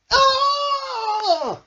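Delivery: high, loud shriek of fright; startled scream. A narrator's reading. Smiley Shark scared by the surprise, shriek. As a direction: scream as if jumping out of your skin.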